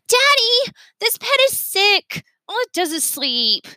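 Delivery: put-on a spoiled kid pleading with daddy; whiny little girl. A narrator's reading. Jane whining to her busy father, high and childish.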